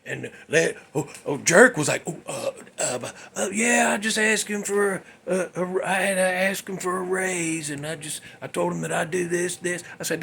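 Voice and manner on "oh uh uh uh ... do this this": softer, halting excuse; flustered stammering. Retold or imitated. Jerk explaining himself after being overheard, flustered stammering. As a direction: stumble through a cover story, caught out.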